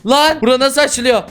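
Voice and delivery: high loud shout; panicked yell. Real, not acted. Trapped, panicking.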